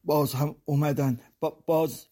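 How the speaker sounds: terrified little voice catching on باز; frightened stammer. A narrator's reading. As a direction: stumble over the words in fright. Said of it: "وحشت زده و با زبان لکنت گرفته گفت".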